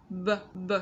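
"B" as a short "buh"; two separate "buh" sounds for "B"; phonics sound. Shown as a demonstration. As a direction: sound out "b" as a letter sound, not its name. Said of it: "Ở phần phonics của chúng ta".

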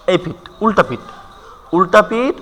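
ordinary talk, nothing marked

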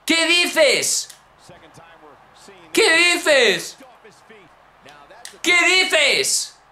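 high, stretched cries falling away; shocked disbelief. Real, not acted. Gamer seeing his rival escape the pin, shocked. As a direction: cry out in stunned disbelief.

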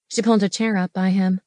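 usual speaking voice

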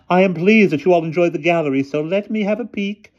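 high, fluting ladylike voice; pleased headmistress. A narrator's reading. Headmistress asks to peek in a bag, high pleased voice. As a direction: address the girls in a high, pleased falsetto.

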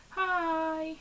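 high, drawn-out hi; cutesy pet greeting. Real, not acted. Vlogger greets the cat who wandered in, cutesy high voice. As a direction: coo a high hello to a pet.

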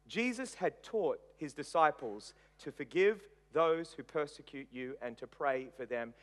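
usual speaking voice

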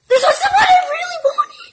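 squealing with laughing delight; excited shriek. Real, not acted. Finds the toy she wanted.

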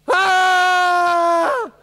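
loud high scream of fright; frightened shout. Acted. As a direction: shriek and bolt. Moabite army sees the body.

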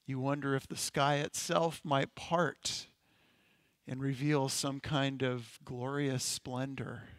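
hushed, contemplative guided imagery; guided meditation. Acted. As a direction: let each phrase settle into stillness. Guided imagination exercise continues.

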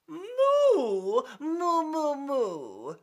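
high, long, swooping moos; drawn-out cow voice; cow mooing. Acted. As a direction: moo like a cow. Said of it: "said the cow".

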